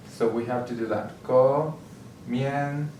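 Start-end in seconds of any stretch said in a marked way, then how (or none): 1.2-2.9 "Comiendo" very slowly in separate syllables, co, mien, do. Shown as a demonstration. Teacher locating the stress for learners, "Comiendo" slowly syllable by syllable. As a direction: break "Comiendo" into three drawn-out syllables. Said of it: "So our stress level is gonna be there".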